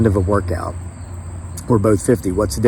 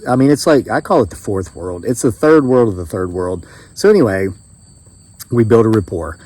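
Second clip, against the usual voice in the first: ordinary delivery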